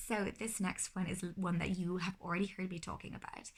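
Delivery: normal talking voice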